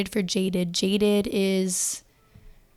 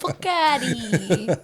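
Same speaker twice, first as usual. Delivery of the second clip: put-on drawn-out, louder Jersey Italian accent; 3 semitones higher, 5 dB louder, much slower than usual. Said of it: "speaking my Jersey Italian accent".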